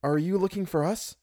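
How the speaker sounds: a small, unsure question; inadequately. A narrator's reading. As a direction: ask it weakly, a little lost. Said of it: "could only say inadequately".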